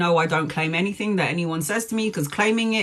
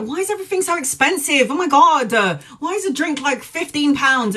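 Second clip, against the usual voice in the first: high-pitched mock outrage at prices; exasperated complaint; 7 semitones higher than usual. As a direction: whine in disbelief, pitched well up. Acted outburst over club prices.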